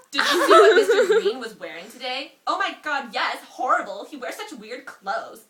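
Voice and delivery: reading the gossip line high and loud through laughter; barely holding back laughter as the girls gossip; laughing. Acted. Reading her old story aloud to a friend, laughing.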